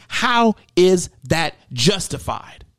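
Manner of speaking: slow, drawn-out how is that justified; slow emphatic question. Shown as a demonstration. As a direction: lean on how, slowly. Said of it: "That's what we're being asked".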